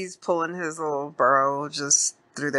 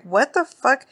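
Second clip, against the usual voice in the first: high, incredulous outburst; mock disbelief, pitched well up; retold disbelief; 9 semitones higher, 4 dB louder than usual. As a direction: imitate a man taken aback. Retold reaction to gun threat.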